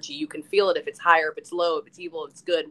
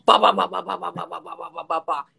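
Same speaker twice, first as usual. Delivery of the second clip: rhythmic "ba ba ba" train noise, fading toward breathless; chugging train sound that fades out; train rumble imitation. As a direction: mimic a train roaring through a tunnel, dying away. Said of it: "it sounds like a train coming through a tunnel".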